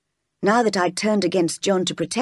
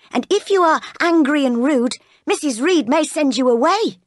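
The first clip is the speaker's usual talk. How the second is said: high-pitched warning; admonishing maid; 8 semitones higher, 4 dB louder than usual. Acted. Bessie warning Jane she may be sent away, admonishing. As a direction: warn the naughty child firmly.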